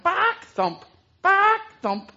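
high loud cluck and thump; limping clucking. Acted. Limping hen clucking and thumping along, high and loud. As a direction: cluck with a limp beat.

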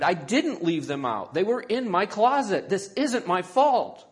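loud, high protest, building; indignant shouting. A narrator's reading. Isabella blamed for the chewed slippers, shouting back. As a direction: shout in self-defence. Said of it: "Isabella shouted".

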